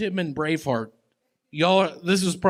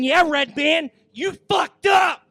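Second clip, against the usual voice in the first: mocking shout; 11 semitones higher than usual. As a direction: yell down at him gleefully. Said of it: "looking at your fucking ass saying".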